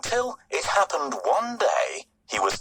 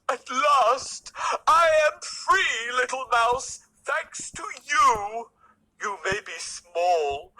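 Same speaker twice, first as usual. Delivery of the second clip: lightly raised, relieved lion voice; grateful lion; 5 semitones higher than usual. A narrator's reading. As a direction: thank the little mouse warmly. Freed lion thanks mouse.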